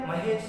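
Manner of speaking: महेश said briefly, with no lengthened "he"; short vowel. Shown as a demonstration. Teacher asking if it is said so, short-vowel महेश. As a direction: ask महेश with a quick, unstretched middle vowel. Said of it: "ऐसा बोलेंगे क्या".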